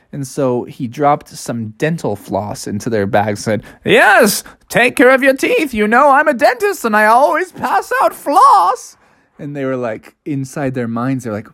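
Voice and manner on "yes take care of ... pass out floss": high, lilting dentist voice; earnest rabbit dentist. Acted. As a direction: advise the kids in a high character voice. Rabbit dentist hands out floss.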